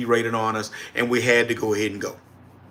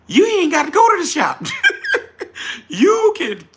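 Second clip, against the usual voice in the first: excited exclamation. Real, not acted. Streamer selling viewers on the laptop, high excited laughing exclamation.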